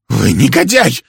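loud, high cry of outrage; outraged woman's shout. A narrator's reading. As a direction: yell the insult at him. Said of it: "кричала она".

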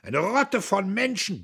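put-on high, loud drunken yell; scream. A narrator's reading. Buck screaming back at Diederich. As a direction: scream it in frenzy. Shouting match continues.

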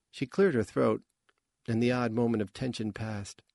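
plain reading voice

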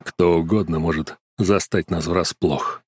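low, slow, smug male voice; arrogant prince. A narrator's reading. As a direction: lord it over her, slow and low. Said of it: "скрестил руки на груди и сказал".

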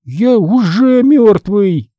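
high, slow undead wail; protesting zombie son. A narrator's reading. Son retorts to his father, high slow zombie drawl.

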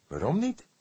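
rising, puzzled question; surprised. A narrator's reading. Fledder questions the cook's hesitation, surprised. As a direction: ask it genuinely taken aback. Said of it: "vroeg hij verbaasd".

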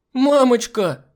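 loud, very high squeal; shrieking. A narrator's reading. Girl seeing the hostess's spots, shrieking. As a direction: squeal it out like a frightened little girl. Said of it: "заверещала Миранда".

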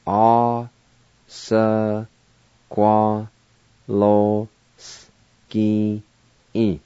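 "A, S, Qua, Lo, Ski-in" with long gaps; syllable by syllable. Shown as a demonstration. Teacher pronouncing sawmill for learners, syllable by syllable. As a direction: hold each syllable of "A, S, Qua, Lo, Ski-in" alone. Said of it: "now I'll pronounce these words".

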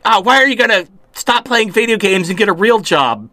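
put-on nagging girlfriend. Girlfriend nagging, voiced by speaker.

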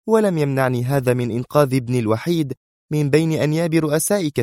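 heated, high-pitched woman's tirade; sharp. A narrator's reading. Sonia rebukes the man who doubted her, sharp. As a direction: sustain a high, sharp boast. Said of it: "وتواصل في حدة".

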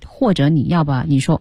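normal talking voice

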